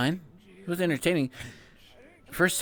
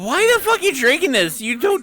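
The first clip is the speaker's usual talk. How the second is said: loud; 9 semitones higher, 8 dB louder than usual. Real, not acted. Outraged at drinking dirty water.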